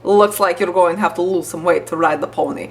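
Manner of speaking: imitated Polish-accented mom. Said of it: "Polish accent".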